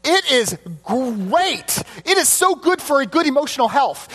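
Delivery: high-pitched gushing, GREAT drawn way out; gush, stretching the praise out; enthusiastic rave. Real, not acted. Preacher recommending Inside Out, gushing enthusiastically. Preacher raving about a film.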